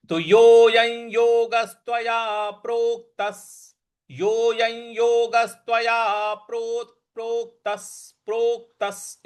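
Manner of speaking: प्रोक्तस with विसर्ग said as स, half क stressed; प्रोक्तस पर बल देकर; teacher's model recitation. Shown as a demonstration. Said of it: "इस प्रकार से बोलना है".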